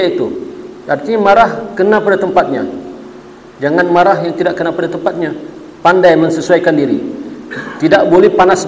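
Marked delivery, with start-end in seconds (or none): none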